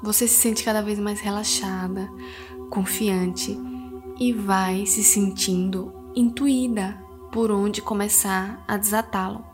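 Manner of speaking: soothing spa whisper. Acted. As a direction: guide them gently into calm. Leads a guided meditation. (voz suave de meditação guiada)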